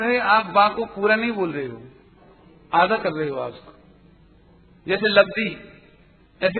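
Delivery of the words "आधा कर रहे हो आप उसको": normal talking voice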